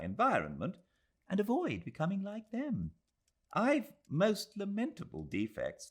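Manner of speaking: put-on a worried young woman thinking aloud; young Englishwoman. Adela confessing her fear to Aziz, earnest.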